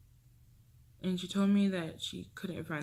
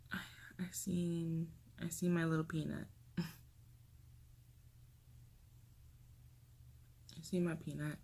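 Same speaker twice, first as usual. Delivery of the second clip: holding back tears; 7 dB quieter than usual. Real, not acted. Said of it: "I'm trying not to cry".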